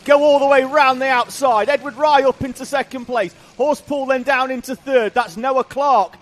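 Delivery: loud, high race call; excited commentary. Acted. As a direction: call the action at full pitch. Live play-by-play of the race.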